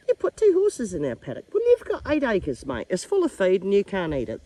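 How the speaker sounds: squabble on behalf of the horses; horses' protest. Acted. Owner acts out horses arguing over the paddock, loud protest. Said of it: "Look at these two going".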